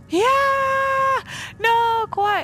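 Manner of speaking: high, loud shriek of terror; terrified scream. Real, not acted. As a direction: scream in sudden panic. Sudden fright in horror game.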